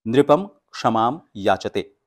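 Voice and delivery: "नृपम क्षमाम याचते" slow, loud, syllables apart; slow model recitation. Shown as a demonstration. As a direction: model the correct way. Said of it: "आपको बोलना है नृपम".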